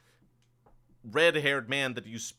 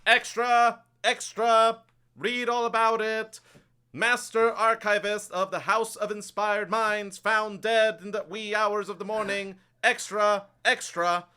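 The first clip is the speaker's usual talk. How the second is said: high, loud street-corner cry of a newspaper hawker; town crier; 10 semitones higher, 6 dB louder than usual. Acted. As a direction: belt out the headlines to the whole street. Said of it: "the sort of town crier will kinda be going".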